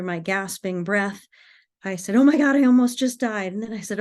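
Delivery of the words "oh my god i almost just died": loud, quick, higher exclamation; retold shock. Driver to herself after surviving a crash, shocked. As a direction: blurt it in acted-out shock.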